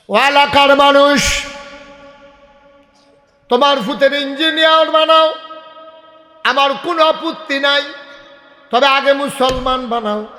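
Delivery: high ringing sermon cry; full-force preaching. Acted. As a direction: belt it out to the crowd, stretching the phrases.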